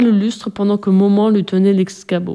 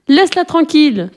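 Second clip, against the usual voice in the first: high protective cry; 7 semitones higher than usual. A narrator's reading. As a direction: cry out to defend someone, voice raised high. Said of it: "cria Magda".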